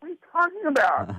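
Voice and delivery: high-pitched retold incredulity; mock bafflement. Employee can't follow his boss, high-pitched mock bafflement. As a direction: act out being totally lost, voice way up.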